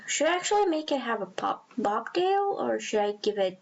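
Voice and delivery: ordinary talk, nothing marked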